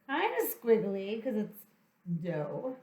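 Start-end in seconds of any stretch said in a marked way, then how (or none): none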